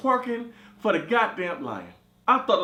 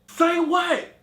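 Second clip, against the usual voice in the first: incredulous; 11 semitones higher, 5 dB louder than usual. Retold or imitated.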